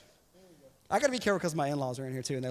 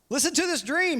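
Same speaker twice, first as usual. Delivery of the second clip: eager Joseph; 11 semitones higher, 6 dB louder than usual. Retold or imitated. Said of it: "he said".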